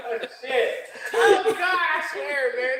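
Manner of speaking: high, helpless laughter through the words; can't stop laughing, nearly crying with it; laughing hard. Real, not acted. Guest cracking up after the host's blunt advice, laughing hard.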